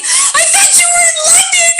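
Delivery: very high-pitched mock shriek of disbelief; shocked outcry. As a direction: squeal it in horrified realisation. Retold shocked realisation about time zone.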